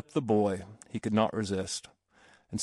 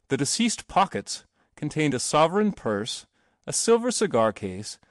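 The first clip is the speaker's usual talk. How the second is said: plain reading voice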